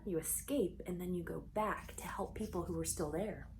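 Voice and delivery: awed hushed whisper; soft unvoiced whisper of amazement. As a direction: whisper it to the kids like it's hard to believe. Awed aside to children.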